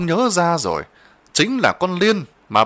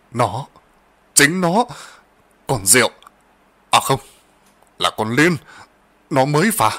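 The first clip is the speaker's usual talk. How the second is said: hesitant broken phrases, a touch of fear; a mild, faltering stammer. A narrator's reading. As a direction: grope for each word, confused. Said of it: "Lão Lộc ấp úng".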